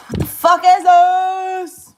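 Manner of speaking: loud, high, drawn-out angry yell; furious outburst. Real, not acted. Venting vlogger exploding at what she just did. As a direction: let it blow up at the screen. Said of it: "I get so mad too".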